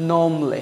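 normally as a short "nom-lee"; normally with silent R, two beats; British pronunciation. Shown as a demonstration. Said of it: "এই শব্দটা কি বলে?".

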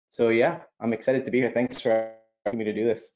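ordinary talk

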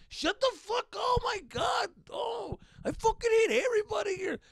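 high, loud furious shouting; exasperated yell. Retold or imitated. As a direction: scream it out, fed up with everyone. Yelling at brother.

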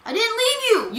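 high, loud denial; indignant protest. Real, not acted. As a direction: protest it loudly, pitch jumping up. Protesting an accusation in game.

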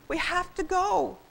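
high, urgent plea; high-pitched entreaty; begging. A narrator's reading. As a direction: beg a brother to leave. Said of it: "begged Karen".

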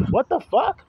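a sudden high What the fuck, disbelieving; incredulous outburst. Real, not acted. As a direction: throw it out in shock at the nonsense. Outraged at false teaching.

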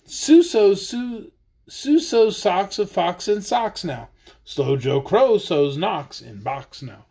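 lively sing-song read-aloud; reading to children. Acted. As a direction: read the rhyme playfully to kids. Reads tongue-twister book aloud.

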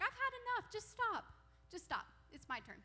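high-pitched mock exasperation; fed up. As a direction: act out someone finally losing patience, pitched well up. Acted prophet finally losing patience.

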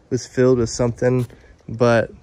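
usual speaking voice

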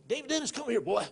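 high, loud call of a stern preacher; stern old preacher. Retold or imitated. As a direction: summon the boy like a fire-and-brimstone preacher. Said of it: "They'd have that Bible".

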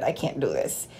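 ordinary talk

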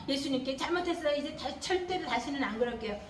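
soft, low sorry prayer; a child softly confessing to Jesus; humble repentance. Retold or imitated. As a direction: model a child's quiet apology to Jesus. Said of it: "이렇게 회개해야 해요".

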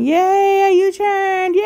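high, loud little victory cheer. Real, not acted. Cheering herself after making the U-turn. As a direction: celebrate the U-turn.

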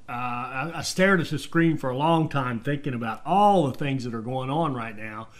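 ordinary talk

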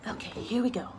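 hushed before reading; whisper. Real, not acted. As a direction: whisper it like a secret. Starting the book.

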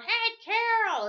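high, loud, drawn-out holler; grandpa impression. Imitating grandpa calling his wife, long high yell. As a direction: holler for a wife, stretching the name. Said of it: "Listen to my grandpa going".

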